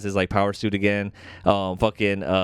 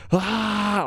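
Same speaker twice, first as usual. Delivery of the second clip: high breathy roar before attacking; mock battle cry; 10 semitones higher than usual. Host imitates the Watcher about to fight her, high battle cry.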